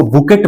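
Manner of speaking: बुकेट with the final t sounded; wrong bouquet pronunciation. Shown as a demonstration. Teacher warns students against a misreading, बुकेट with sounded t. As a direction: misread it as बुकेट, hard t ending. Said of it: "मत पढ़िएगा".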